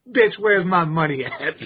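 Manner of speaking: lightly voiced pimp demanding payment. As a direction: play a mock pimp, half laughing. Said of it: "He, he's a pimp".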